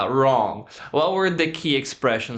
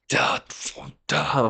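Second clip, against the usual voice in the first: deep voice. Retold or imitated. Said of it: "this voice like that".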